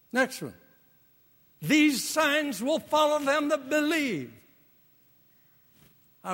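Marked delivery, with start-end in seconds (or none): 1.6-4.3 higher scripture proclamation; scripture reading. Acted. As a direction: read the verse with lift. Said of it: "quoting scripture".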